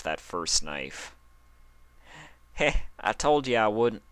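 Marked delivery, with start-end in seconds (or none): 2.5-4.0 smirking. Acted. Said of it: "Applejack's smirk".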